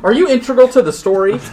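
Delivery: high, loud, overly eager question; mock earnest question. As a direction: blurt it out like a naive adventurer, pitched high. Acting the odd question.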